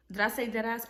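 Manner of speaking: ordinary talk, nothing marked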